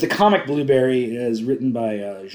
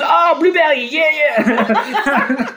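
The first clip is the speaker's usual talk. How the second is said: excited French clerk, much higher and louder; hammed-up French accent, bursting with recognition; 8 dB louder, much slower than usual. Store clerk finally recognizing the title, excited French accent. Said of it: "put that accent on it".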